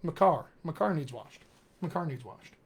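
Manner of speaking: "My" said as a short "muh" before "car"; lightly slurred Pittsburgh "My car needs washed"; reduced "my". Shown as a demonstration. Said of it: "you can say, like, rather than".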